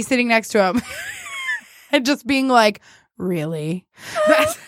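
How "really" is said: low, soft deadpan question; unamused. Acted. Host imagining her reaction beside weary passenger, unamused. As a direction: say it thoroughly unimpressed, quietly. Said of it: "his face is just so unamused".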